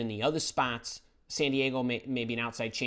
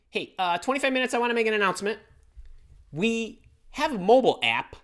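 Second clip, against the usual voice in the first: much higher and louder, fast and eager; excited announcement; 9 semitones higher, 7 dB louder, faster than usual. Real, not acted. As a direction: call out the big news to the room. Making a live announcement.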